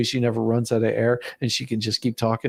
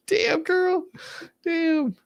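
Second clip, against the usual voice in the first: delighted squeal; much slower than usual. Real, not acted. Reacting to the woman in the clip, a high delighted squeal.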